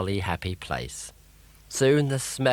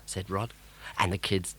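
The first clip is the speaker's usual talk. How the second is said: plain reading voice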